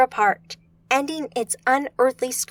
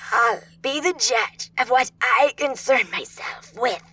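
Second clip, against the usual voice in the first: scratchy smoker voice. A narrator's reading. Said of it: "its voice scratchy".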